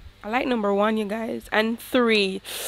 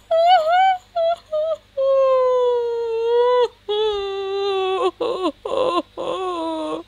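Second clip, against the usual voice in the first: a long, high half-laugh, half-sob; high wailing laugh-cry; 12 semitones higher than usual. Real, not acted. Wailing laugh at the ugly nose in her game. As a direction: laugh and wail at once in despair. Reacting to ruined face.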